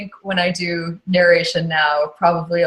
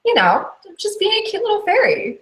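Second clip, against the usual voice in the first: much higher, sweet fairy voice; cute little fairy; 14 semitones higher than usual. Shown as a demonstration. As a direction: play a tiny cute fairy. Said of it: "as Mary".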